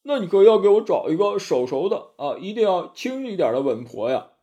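high, loud, hurried pig demon voice; anxious Zhu Bajie begging for a midwife; hasty Bajie. A narrator's reading. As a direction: blurt it out in a panic, then settle. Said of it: "急忙道".

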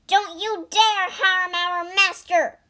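high, loud protective threat; fierce warning. Acted. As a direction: warn the tiger off hard. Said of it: "Bajie warned".

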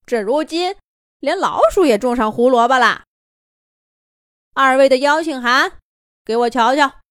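拖腔带调的嘲讽腔; 斜眼挖苦地说; 阴阳怪气. Acted. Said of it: "阴阳怪气儿地说了一句". (懒洋洋又阴阳怪气)

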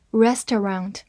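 American "restaurant" in three syllables; American accent variant. Shown as a demonstration. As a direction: say "restaurant" the American way, REST-er-ahnt. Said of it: "或者restaurant都可以".

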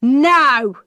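put-on loud sharp no from the old man; angry cry. A narrator's reading. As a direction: throw out a furious refusal. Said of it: "he cried".

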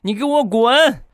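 high, furious shriek; angry girlfriend screaming get out; yelling get out. Acted. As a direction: scream at him to get lost. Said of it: "指着她老公大喊".

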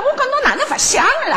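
retold excited question, pitched high. Daughter questions mother about her classmate, very excitedly. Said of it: "我激动得了不得了".

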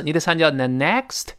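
ordinary talk, nothing marked

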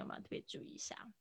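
hushed whispered aside; quick whispered aside. Real, not acted. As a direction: whisper it off to the side. Teacher aside while call drops.

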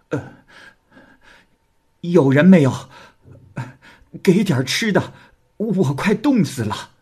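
high, loud, desperate calls for food; pitiful begging cry. A narrator's reading. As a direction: cry out for food, freezing and starving. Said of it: "就连声叫喊道". (凄惨地高声乞讨)

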